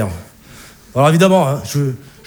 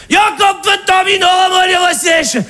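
gruff father; 13 semitones higher, much slower than usual. Acted. Alsatian father greeting.

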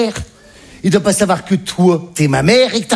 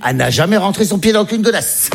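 usual speaking voice

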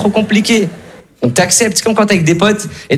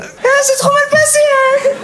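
very high, theatrical whine; hammed-up high-pitched lament; mock dramatic complaint; slower than usual. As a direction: wail it like a disappointed customer. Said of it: "T'as déjà vu un mec, il est sorti comme ça?".

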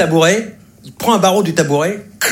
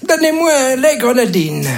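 put-on frail old man; 6 semitones higher than usual. Teller voices a frail little old man.

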